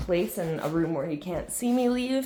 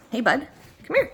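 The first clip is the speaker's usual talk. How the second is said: talking to a dog; 7 semitones higher than usual. Real, not acted. Calling the dog over.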